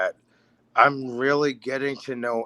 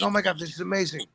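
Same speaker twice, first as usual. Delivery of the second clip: excited; 6 semitones higher, 4 dB quieter, much faster than usual. Retold or imitated. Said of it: "I'm like".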